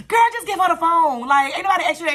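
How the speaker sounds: high, loud snapping back at mom; exasperated retort. Retold or imitated. Snapping at her mother to hand over the phone, loud and high. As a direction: push back hard, fed up.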